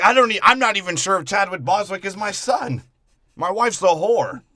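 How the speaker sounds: loud, high-pitched retort; mock outraged father. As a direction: play a father loudly venting his grievance. Acted father's retort in film retelling.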